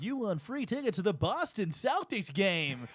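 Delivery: slow, high, swooping announcer hype; drawn-out contest-winner call; radio announcer. Acted. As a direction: announce a prize like a radio host. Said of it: "pretended to be, like, a radio announcer".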